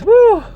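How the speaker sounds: high, loud whoop; a real whoop of disbelief; woo yell. Real, not acted. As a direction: let out a sudden loud woo. Bloated after eating pizza.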